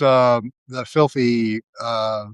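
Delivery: ordinary delivery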